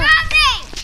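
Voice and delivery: high, loud call for the dog. Real, not acted. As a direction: shout for the dog. Calling the dog.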